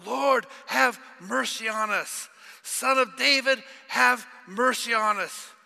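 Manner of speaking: high, loud, slow pleading cry. Acted. Blind men on the roadside begging Jesus, loud pleading cry. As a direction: beg at the top of the voice. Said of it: "they cried out".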